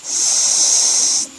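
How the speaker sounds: creature's lingering hush; long hushing. A narrator's reading. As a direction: hiss a long "sssst" for silence. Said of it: "sambil berucap".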